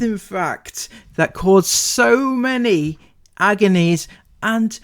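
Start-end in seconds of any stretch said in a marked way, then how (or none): none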